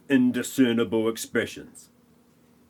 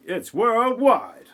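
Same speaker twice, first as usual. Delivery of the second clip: high, slow alarmed voice; mock alarmed newscaster; 11 semitones higher, slower than usual. Voicing a newscaster reporting the disappearances, high and slow in mock alarm. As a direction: draw it out in mock alarm, pitched up. Newsroom scene voiced in recap.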